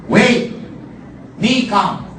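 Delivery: chief's broken English, cut short. Retold or imitated. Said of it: "Because it's Barong English".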